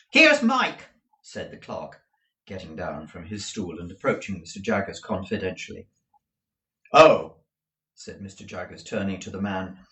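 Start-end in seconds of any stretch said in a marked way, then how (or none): 0.1-0.9 high, loud announcing clerk. Acted. 6.9-7.4 loud, curt acknowledgement; brief lawyer reply; lawyer's curt oh. Acted. Jaggers reacting.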